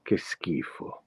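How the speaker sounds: "Che schifo" whispered in Italian, "skifo" with a hard k; Italian: "Che schifo" sussurrato; Italian disgust. Shown as a demonstration. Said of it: "I wanna talk about that word schifo".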